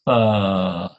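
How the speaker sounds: "fast" stretched into a long, low "faaa"; said slowly. Shown as a demonstration. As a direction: drag the vowel out, sinking in pitch. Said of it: "you cannot say the word fast slowly".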